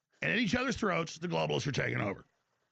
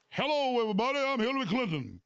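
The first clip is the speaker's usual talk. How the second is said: higher, put-on crowd-pandering accent; Hillary Clinton; 5 semitones higher than usual.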